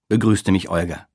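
ordinary delivery

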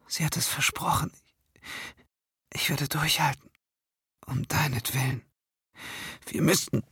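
high, weak voice of a dying woman; frail shaky whisper. A narrator's reading. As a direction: barely hold on, whisper each word. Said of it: "ihre schwache Stimme".

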